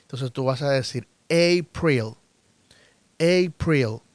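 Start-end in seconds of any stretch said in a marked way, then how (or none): 1.3-4.0 April starting with a long "ei", "EI-pril". Shown as a demonstration. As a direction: open April on a stressed "ei" glide. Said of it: "se pronuncia como E o como E I". (April con la A como "ei")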